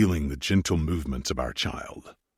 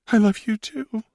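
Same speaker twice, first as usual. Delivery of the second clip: higher woman's voice, a touch shaky; 3 dB louder than usual. Acted. Sarah, moved, answers Maximus in a high, slightly shaky voice. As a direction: let it tremble a little, high and moved. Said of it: "Sarah said in a shaky voice".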